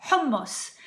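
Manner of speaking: hummus as HOM-moos, rounded vowels; correct pronunciation. Shown as a demonstration. Cook naming the best dip, properly pronounced. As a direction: say hummus with an Arabic h and long m. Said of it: "So it's just hummus".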